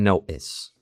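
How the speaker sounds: "notice" with the T swallowed into a catch; "notice" as "no'ice"; glottal stop T. Shown as a demonstration. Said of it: "C E".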